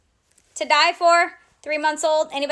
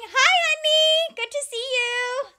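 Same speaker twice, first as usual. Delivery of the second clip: much higher, stretched-out honeyed greeting; sweet greeting; 9 semitones higher than usual. Real, not acted. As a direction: greet a little one in a high singsong. Greets a child watching the stream.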